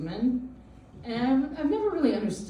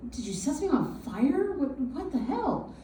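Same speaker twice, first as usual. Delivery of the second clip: higher-pitched incredulous question; retold bewilderment; 6 semitones higher than usual. Mother questioning her upset son, retold bewilderment. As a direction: demand to know, half baffled. Acted baffled reply to son.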